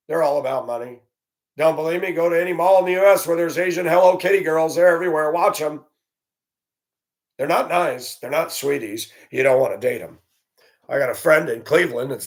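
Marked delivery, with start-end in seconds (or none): none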